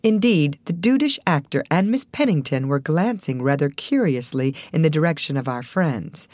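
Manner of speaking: usual speaking voice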